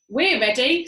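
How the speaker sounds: loud answer; eager reply. A narrator's reading. Birds answering ready, loud. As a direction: call it back keen.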